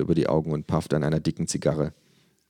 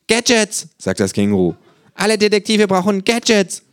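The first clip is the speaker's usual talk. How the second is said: much higher, louder eager kangaroo; 7 dB louder than usual. Acted. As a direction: sell it like a gadget nerd. Känguru voiced by narrator.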